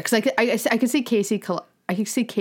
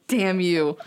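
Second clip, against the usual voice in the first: ordinary delivery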